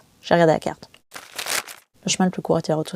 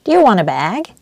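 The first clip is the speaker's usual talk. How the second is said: higher, louder, bright customer-service English; visitor center lady; 4 semitones higher, 8 dB louder, slower than usual. Retold or imitated. Visitor center lady offering the narrator a bag, chirpy clerk voice. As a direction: chirp it like an eager shop clerk. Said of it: "Elle m'a demandé".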